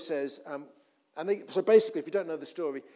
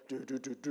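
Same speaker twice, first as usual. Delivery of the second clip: soft ominous "do do do" soundtrack; imitated background music; 4 semitones lower, 6 dB quieter than usual. Preacher imitates music behind the bad report, softly. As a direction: softly mouth a film-score sting. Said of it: "like, the music in the background".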